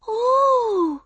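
put-on long, very high child's "ooh"; awed small-child squeal; high-pitched little girl. A narrator's reading. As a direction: coo in wonder as a tiny kid. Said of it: "said a high-pitched voice".

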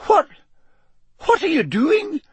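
very high, halting, shocked question. Acted. McGonagall watching the bouncing ferret, shocked. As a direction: stammer it in high disbelief.

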